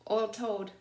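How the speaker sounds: ordinary delivery, nothing marked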